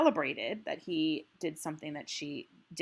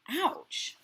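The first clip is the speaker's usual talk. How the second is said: voiced ouch; 11 semitones higher, 4 dB louder than usual. A narrator's reading. Bumping heads in the story.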